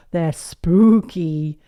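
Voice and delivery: loud, slow "spooky"; drawn-out SPOOKY. Acted. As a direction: stretch "spooky" out long and loud. Peppa stresses that cakes are spooky.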